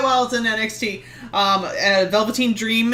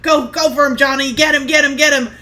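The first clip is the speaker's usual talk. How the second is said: high-pitched fan urging on a favorite; urgent, raised-voice rooting; excited cheering; 6 semitones higher, 4 dB louder than usual. Retold or imitated. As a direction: cheer on a wrestler, re-enacted. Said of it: "And I'm like".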